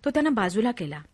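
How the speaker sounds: ordinary delivery, nothing marked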